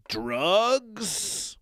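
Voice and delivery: ordinary talk, nothing marked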